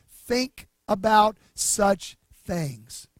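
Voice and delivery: "Think about such things" very slow, each word weighted; emphatic slow. Shown as a demonstration. Pastor stressing Paul's four words to the congregation, slowly. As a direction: land the four words one by one. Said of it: "Here come the four words".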